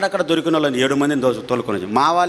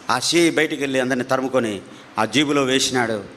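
ordinary talk

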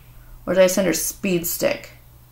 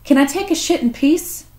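higher put-on voice of a fed-up dog; dog's voice; 7 semitones higher than usual. Vlogger voicing her dog caught mid-poop, higher put-on voice. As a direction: voice the dog, begging for privacy. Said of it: "He's like".

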